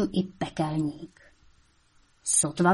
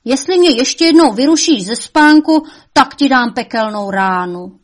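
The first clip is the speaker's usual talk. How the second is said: higher, loud threat; threatening devil; 5 semitones higher, 9 dB louder than usual. A narrator's reading. Devil threatening his mother not to wake him. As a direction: warn her off sharply.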